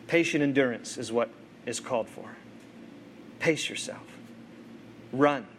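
soft, low preaching with long pauses; solemn closing exhortation. Real, not acted. Preacher closing the sermon to the congregation, hushed solemn exhortation. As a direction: land each phrase quietly and earnestly.

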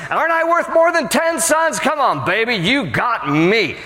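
very high, pleading husband voice; mock clueless husband. Pastor mocking Elkanah asking if he's worth ten sons, high and hammed-up. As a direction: ham up a husband fishing for praise. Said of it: "He goes".

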